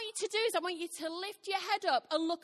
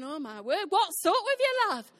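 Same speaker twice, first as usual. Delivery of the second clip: louder, higher worried husband; husband's concern; 4 semitones higher, 7 dB louder than usual. Retold or imitated. Husband finding his wife crying, voiced alarmed and louder. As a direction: imitate the husband asking what's wrong. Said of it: "And Chris is going".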